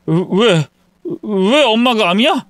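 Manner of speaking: high, tearful protest; distraught son breaking into tears. Acted. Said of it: "눈물을 보였어요".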